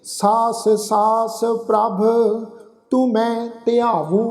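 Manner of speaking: slow, drawn-out chanted reading of the verse; measured Gurbani path recitation; slow scripture recitation. Shown as a demonstration. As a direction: recite each line slowly and reverently. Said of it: "ਠੀਕ ਹੈ ਨਾ ਜੀ".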